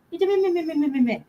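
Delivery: cutesy sing-song cooing to a cat. Real, not acted. Cooing cat's nickname at cat.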